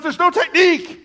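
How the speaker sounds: very high, loud comic yell; retold exasperated retort. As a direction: yell back in mock disbelief. Acts friend's exasperated answer.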